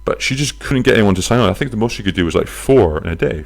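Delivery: usual speaking voice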